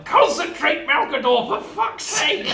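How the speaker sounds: high, loud yell of frustration, breaking into laughter. Acted. Player yelling at own character to concentrate, exasperated yell. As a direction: yell it like a desperate self-scolding. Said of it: "just going to yell".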